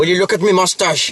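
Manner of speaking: mock Irish. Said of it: "Irish or Scottish accent".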